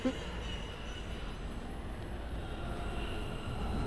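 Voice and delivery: unvoiced high wheeze of a laugh; breathless wheezing laugh. Real, not acted. Streamer wheezing with laughter after being caught. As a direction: wheeze silently in defeat.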